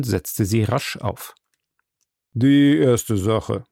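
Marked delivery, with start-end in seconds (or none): 2.4-3.6 the judge opens court, slow and drawn out; each word weighed and stretched. A narrator's reading. Judge opening the first case, slow and stately. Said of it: "sprach er".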